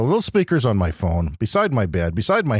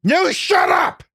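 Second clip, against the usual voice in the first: furious shout; much slower than usual. Real, not acted. Telling off the smart speaker that butted in, furious shout. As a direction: shout it down, sharply and slowly. Yelling at Alexa to stop.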